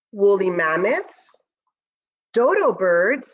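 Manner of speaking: slow, gentle read-aloud voice; storybook narration. Acted. Teacher reading Moon's First Friends to students, storybook voice. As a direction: read it like a bedtime story for the class. Reads picture book aloud.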